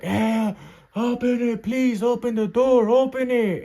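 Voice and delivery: high, drawn-out mock pleading cry; desperate begging. Inmate begging guards to open the door, acted desperate pleading. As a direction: plead frantically to be let out. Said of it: "begging to open up the door".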